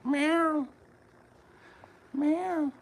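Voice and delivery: high squeaky cat meow; imitated meow. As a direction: mimic a little cat meowing.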